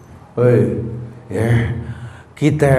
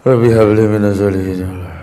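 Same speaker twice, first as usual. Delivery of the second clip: pleading prayer; 8 semitones lower than usual. Shown as a demonstration.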